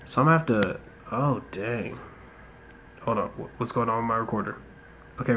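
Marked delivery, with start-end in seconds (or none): none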